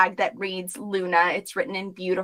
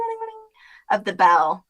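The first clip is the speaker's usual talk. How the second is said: ordinary talk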